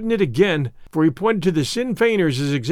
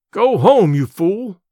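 shouting; 3 semitones higher, 6 dB louder, much slower than usual. Acted. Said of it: "I shouted".

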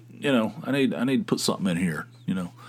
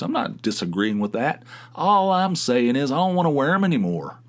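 normal talking voice

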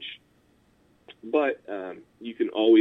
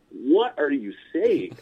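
baffled retort; 6 semitones higher, slower than usual. Retold or imitated. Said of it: "I'm just like".

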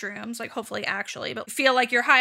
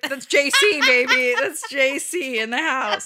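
hype bro; 2 semitones higher, 4 dB louder, slower than usual. Retold or imitated. Hyping Jesus at church.

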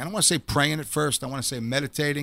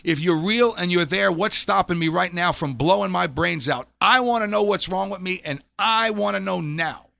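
a man on a rooftop yelling up at God; desperate yell at God; 4 semitones higher, 3 dB louder than usual. Retold or imitated. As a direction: demand an answer from God, voice straining. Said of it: "to yell, to scream".

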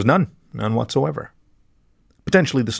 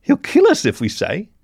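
weeping fear; 10 semitones higher, 4 dB louder than usual. A narrator's reading. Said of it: "where he wept openly and severely".

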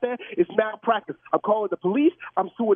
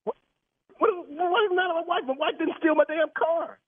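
high, stunned disbelief; shocked; 6 semitones higher than usual. Real, not acted. Car owner stunned that the caller knows his wife. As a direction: caught completely off guard.

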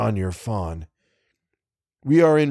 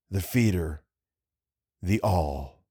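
hushed ominous refrain; 4 semitones lower, 6 dB quieter, slower than usual. A narrator's reading. Refrain of the horror's name.

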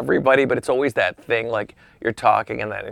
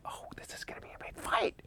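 hushed, breath-only anticipation; thrilled whisper; 18 dB quieter, faster than usual. Retold or imitated. Golfer watching O J turn round, excited whisper.

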